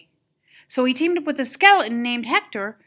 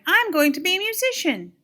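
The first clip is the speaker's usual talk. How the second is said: excited; 7 semitones higher than usual. A narrator's reading. Miguel declares his dream.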